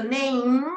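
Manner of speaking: "name" said so its closing consonant is heard clearly; final sound demo. Shown as a demonstration. Teacher asks the class to hear the last sound. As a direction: land clearly on the end of "name". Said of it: "el último sonido que ustedes escuchan".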